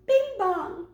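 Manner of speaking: high sung two-note bing bong; doorbell chime imitation. Imitating the liquor aisle doorbell with a high two-note chime. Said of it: "and it goes".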